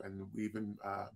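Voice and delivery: ordinary talk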